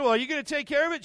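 put-on high, fast, whiny child voice; hurt boy begging mom for help, high and hurried; pleading kid. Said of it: "I said".

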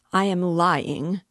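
loud, drawn-out indignant echo; stretched, affronted disbelief; incensed repeat. A narrator's reading. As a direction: throw the accusation back slowly and loudly. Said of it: "Porfiri repeated, apparently incensed".

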